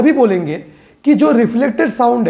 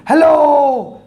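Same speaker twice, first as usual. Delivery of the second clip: a higher, calling hello; shouted hello; 4 semitones higher, much slower than usual. Shown as a demonstration. Teacher demonstrating calling hello toward a mountain, shouting. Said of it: "चिल्ला चिल्ला के ऐसे बोल रहा हूँ".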